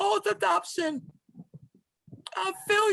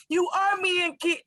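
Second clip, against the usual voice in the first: ordinary talk, nothing marked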